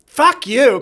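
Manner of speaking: high, loud shouted retort; yelling it at the husband who was right; angry retort. Retold or imitated. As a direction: spit it back at him in outrage.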